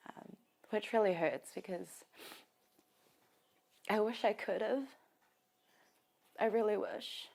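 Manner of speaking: choked up, voice pitched high with hurt; real grief. Real, not acted. Sister regrets she couldn't save her brother, tearfully grieving. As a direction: fight through tears, halting between phrases.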